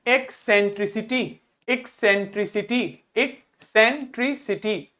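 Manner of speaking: एक्सेंट्रिसिटी broken into clear syllables; pronunciation drill. Shown as a demonstration. Teacher has class read next word, syllable-by-syllable एक्सेंट्रिसिटी. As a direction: sound out एक्सेंट्रिसिटी part by part. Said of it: "word पढ़िए".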